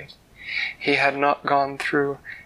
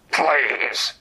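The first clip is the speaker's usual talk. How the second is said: growled please; 3 semitones higher, 6 dB louder than usual. Acted. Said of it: "he would sort of growl while saying please under his breath".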